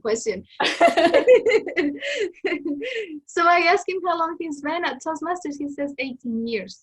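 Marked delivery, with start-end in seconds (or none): 0.4-3.3 a loud, high burst of laughter; hearty laugh. Real, not acted. Laughing burst while sharing a Toastmasters story.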